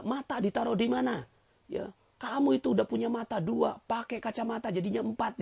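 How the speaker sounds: high, reproachful voice of a nagging wife; hammed-up wife chiding her husband; mock scolding wife. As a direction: scold like a fussy wife at home.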